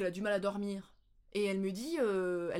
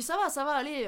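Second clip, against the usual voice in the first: higher, louder insistent reassurance; brushing-off reassurance; 7 semitones higher, 6 dB louder than usual. Retold or imitated. Upset learner sending delivery man off, insistent brush-off. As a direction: wave someone away, insisting all is fine.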